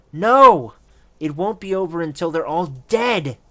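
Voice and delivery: loud, high cry of protest; distraught outburst. Acted. Daughter insisting to her father it isn't over, distraught shout. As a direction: burst out loud and high, easing only slightly.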